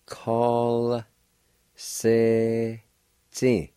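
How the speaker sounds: Call, se, ti split into separate syllables with pauses; syllable-by-syllable demo. Shown as a demonstration. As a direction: break Call se ti into parts for the learner. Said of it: "You can pronounce the L sound with the K A like this".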